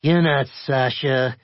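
high, deliberate challenge; suspicious caller. A narrator's reading. Caller sees through the disguise.

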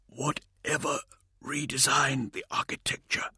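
strained, effortful voice; struggling to speak. A narrator's reading. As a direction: force each word out, weakened. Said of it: "struggling to speak".

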